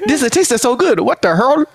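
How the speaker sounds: Chinese grandpa voice. Retold or imitated. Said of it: "like a Chinese grandpa".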